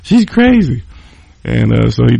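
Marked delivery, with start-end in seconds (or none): 0.0-0.8 high, loud, urgent begging to be let in; desperate shout through the door; panicked plea. Retold or imitated. Airman pounds on his buddy's door begging for refuge, panicked high shout.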